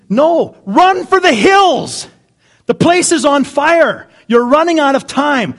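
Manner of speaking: loud, high, urgent pulpit preaching; preaching at full force. Acted. Pastor warning the congregation about fire, full force preaching. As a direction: drive it home like a fire alarm.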